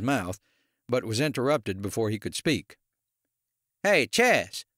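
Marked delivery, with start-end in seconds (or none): 3.8-4.6 higher-pitched friendly hail; raised voice to get someone's attention; calling out. A narrator's reading. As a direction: call a colleague over. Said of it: "Paul called to him".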